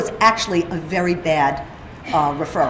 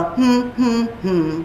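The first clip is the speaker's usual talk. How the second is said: hummed tones; much slower than usual. Shown as a demonstration. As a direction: hum the tone pattern like a child being tested. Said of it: "ask the child to hum it".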